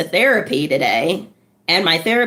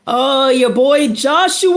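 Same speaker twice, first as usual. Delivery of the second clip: put-on louder, higher New York accent on Joshua; shout-out in a New York accent; 5 semitones higher, 4 dB louder than usual. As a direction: hype it up New York style. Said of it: "New York accent".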